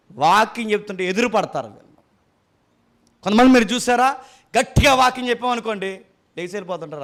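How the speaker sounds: ordinary talk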